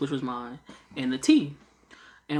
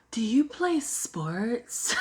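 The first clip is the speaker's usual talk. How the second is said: slow, higher, amused imitation of a sweet lady; seatmate lady; 7 semitones higher, much slower than usual. Retelling the plane seatmate's question, mock sweet lady voice. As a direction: drawl out the polite stranger with a giggle. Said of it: "the lady on the side was like".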